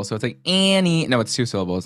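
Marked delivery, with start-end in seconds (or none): 0.4-1.1 ANNIE high and stretched as if one syllable; high, drawn-out "Annie"; name run together. Shown as a demonstration. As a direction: glide through "Annie" in one high sweep. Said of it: "It's, like, one syllable".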